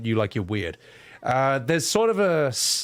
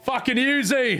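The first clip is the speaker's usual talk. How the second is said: higher, excited laddish mate; lads' banter, pitched up; imitating a footie fan; 7 semitones higher than usual. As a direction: ham up the football bro who won't shut up. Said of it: "He's like".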